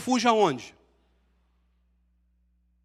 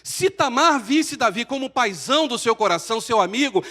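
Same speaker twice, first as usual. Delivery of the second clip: ordinary talk, nothing marked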